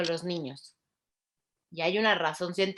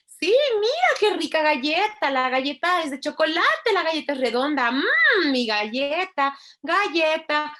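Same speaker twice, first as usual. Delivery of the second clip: much higher, louder singsong delight; lots of intonation; 8 semitones higher, 8 dB louder than usual. Shown as a demonstration. Showing parents how to talk to a child with rich intonation, delighted singsong. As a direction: sell the cookie like the best thing ever. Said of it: "usar muchísima entonación".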